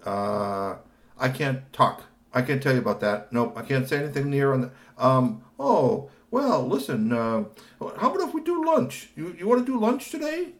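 high, loud hurried dodge; change the subject quickly; evasive Kashi voice. Acted. Kashi avoiding the question, evasive.

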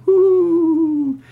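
long high hoo like an owl; owl hoot. Acted. Narrator imitating the owls, a long high hoot.

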